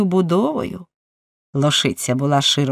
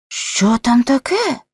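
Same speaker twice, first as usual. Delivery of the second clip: slow, rising startled exclamation; exclaimed question; 7 semitones higher, slower than usual. A narrator's reading. Irish servant reacts as the dog jumps up, exclaiming. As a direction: call it out higher, stretching the words. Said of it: "вигукнув ірландець".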